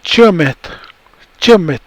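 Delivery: chumit with a light ch start; native word demo. Shown as a demonstration. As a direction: say chumit slowly and clearly. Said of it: "so you say".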